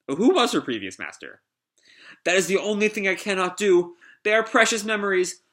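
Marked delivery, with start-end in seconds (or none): 2.2-5.4 high, earnest robot voice; pleading android. Acted. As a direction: refuse in a high, measured, sorrowful voice. Pino refuses to erase memories.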